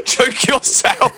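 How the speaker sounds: high, drawn-out mock command. Man echoing friend's bedroom joke, high mock command. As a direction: stretch it out slowly in a high joking voice.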